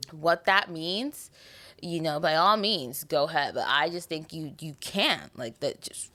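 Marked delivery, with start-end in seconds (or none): none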